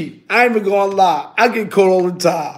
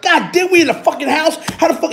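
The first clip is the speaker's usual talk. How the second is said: mock startled; 8 semitones higher than usual. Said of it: "I'm like".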